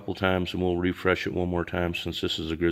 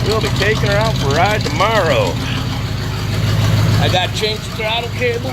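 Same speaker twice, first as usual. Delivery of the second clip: raised, higher voice over motor noise; shouting over engine; 10 semitones higher, 7 dB louder than usual. Real, not acted. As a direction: project hard to be heard above the engine. Talking over a running engine.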